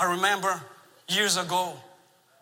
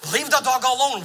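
louder, firm stern order; father sternly ordering his daughter, louder; stern command; 5 semitones higher, 6 dB louder than usual. Retold or imitated. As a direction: lay down the law to the child. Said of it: "I'm really stern with her".

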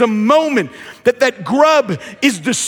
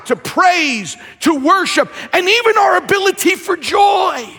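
preaching; 4 semitones higher than usual. Acted. Preacher builds on worship lost.

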